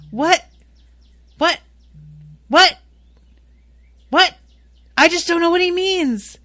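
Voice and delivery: high, loud repeated cries of what; loud, high outraged confusion; stunned disbelief. Real, not acted. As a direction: keep exclaiming, completely baffled. Repeated stunned outbursts at plot twist.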